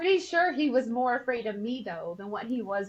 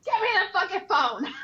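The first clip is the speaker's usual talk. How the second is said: higher, louder, half-laughing snap; retold angry demand; 7 semitones higher, 3 dB louder than usual. Wife demanding the phone from her husband, angry. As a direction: grab the phone, fed up.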